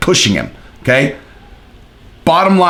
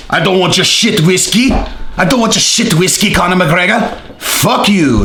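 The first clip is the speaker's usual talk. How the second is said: hammed-up Irish accent, pitched higher; bad Irish accent; 4 semitones higher than usual. Bar patron refusing Conor's whiskey, bad Irish accent. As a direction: put on a terrible mock Irish accent. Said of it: "That's the worst Irish accent ever".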